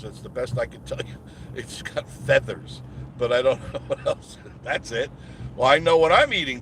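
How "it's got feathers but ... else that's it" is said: laughing through the words; chuckling over roadkill. Real, not acted. Vlogger laughing about roadkill on the road.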